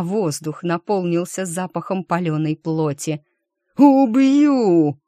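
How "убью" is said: loud snarling roar; growling threat. Acted. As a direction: roar it like a beast. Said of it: "прорычал вор длинноухий".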